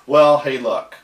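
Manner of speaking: ordinary talk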